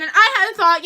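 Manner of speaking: normal talking voice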